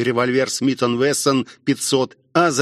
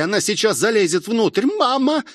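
rising high into alarm; mounting acted panic; panicky; 8 semitones higher than usual. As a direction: climb in pitch to a frightened cry. Panic as attacker climbs in.

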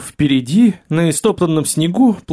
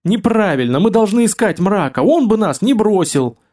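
tearful protest; 7 semitones higher than usual. Acted. Таргитай спорит со слезами.

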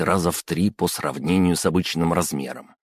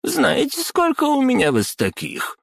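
high squealing outburst; shrieking; 13 semitones higher than usual. Acted. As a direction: shriek at him like a squeaky toy. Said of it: "взвизгнула дама".

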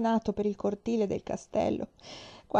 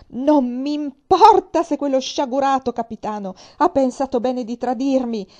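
loud, raging exclamation, higher than usual; furious outburst; 5 semitones higher, 10 dB louder than usual. Acted. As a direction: spit it out in pure fury. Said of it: "Io mi infuriai". (esclamazione furiosa, più alta e forte)

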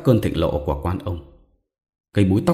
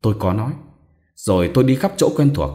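usual speaking voice

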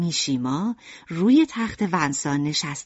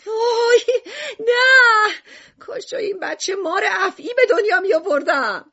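much higher, loud crying wail; wailing shout; 4 dB louder than usual. A narrator's reading. Mother Lucrèce yells from kitchen, loud crying wail. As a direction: wail as if in despair. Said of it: "لوکریس از آشپزخانه داد زد".

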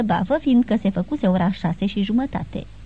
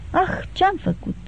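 higher, slow cry of remorse; dismayed exclamation; 9 semitones higher, slower than usual. Acted. Levin to weeping Kitty, exclaiming in remorse. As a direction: realize the harm you caused, lightly voiced. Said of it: "exclamă Levin".

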